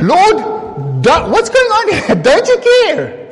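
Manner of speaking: high, loud frantic plea; terrified fisherman shouting over the storm; panicked Peter. Retold or imitated. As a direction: cry out to a sleeping master in panic. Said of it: "probably Peter going".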